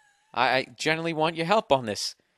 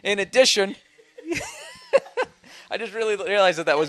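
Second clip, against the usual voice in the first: high, loud words broken by laughing; real laughter; 8 semitones higher, 4 dB louder than usual. Real, not acted. Host cracking up over axe murderer outside, laughing. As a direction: try to carry on reading through the giggles. Tries reading on while laughing.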